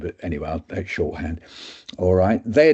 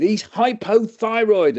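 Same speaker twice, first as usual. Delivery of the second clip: much higher, slow announcement; triumphant verdict; 12 semitones higher, 4 dB louder, slower than usual. Retold or imitated. Doctor tells students the answer, high and slow. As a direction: deliver the diagnosis grandly.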